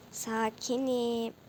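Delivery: slow, stretched-out calm; self-soothing calm. Real, not acted. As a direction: talk yourself down slowly. (ağır ağır, sakinleşmeye çalışarak)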